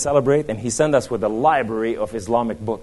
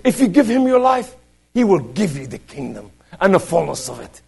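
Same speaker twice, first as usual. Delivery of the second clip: high, loud pulpit proclamation; forceful preaching; 10 semitones higher, 6 dB louder than usual. Acted. Preacher exhorting the congregation about God's power, high and forceful. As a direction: preach it out, punching each phrase high.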